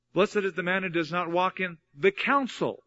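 pause, then lift the word "counsel"; stress on COUNSEL. Shown as a demonstration. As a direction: run up to "counsel", then lean on it. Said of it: "emphasize different words in it".

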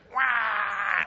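short high imitated baby cry; a quick hammed-up baby "wah"; mock baby wail. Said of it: "instead of crying".

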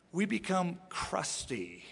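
ordinary talk

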